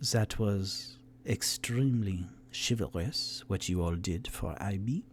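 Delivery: a cool lady exhaling smoke; tiefling woman speaking smooth and low to the heroes; suave tiefling. Acted. Tiefling NPC voice.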